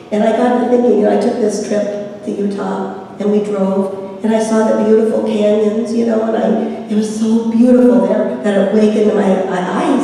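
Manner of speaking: ordinary delivery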